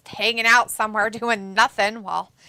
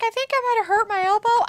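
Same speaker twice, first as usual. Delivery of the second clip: mock whimper; 9 semitones higher, faster than usual. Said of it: "He's like".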